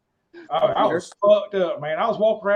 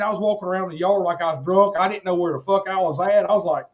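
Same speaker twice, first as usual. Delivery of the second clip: ordinary talk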